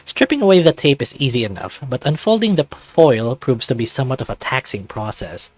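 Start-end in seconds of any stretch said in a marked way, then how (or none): none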